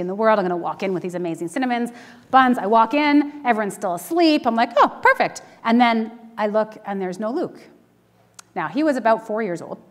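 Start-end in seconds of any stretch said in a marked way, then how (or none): 4.8-5.4 high mock-cheerful oh perfect; a bright, much higher sing-song relief; sarcastic relief. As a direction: chirp it up high, fake delight. Relieved everyone still asleep.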